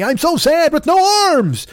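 high, hammed-up self-pitying wail; mock sad. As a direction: wail it like a pity party. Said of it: "he's the sad one".

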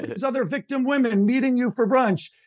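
hammed-up furious PR man; mock yelling boss. PR boss yelling at his client over speakerphone, mock exasperated. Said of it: "He's like".

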